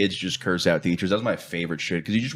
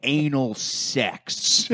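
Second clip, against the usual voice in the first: teacher voice; 3 semitones higher, much slower than usual. Retold or imitated. Quoting the sex-ed teacher.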